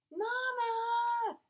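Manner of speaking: put-on high, loud children's shout for mom; yelled call. A narrator's reading. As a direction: yell for Mama in alarm. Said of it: "the twins yelled".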